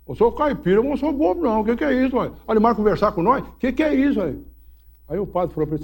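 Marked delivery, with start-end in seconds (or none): 0.1-4.3 menino caipira desconfiado. Retold or imitated. Menino duvida do padre.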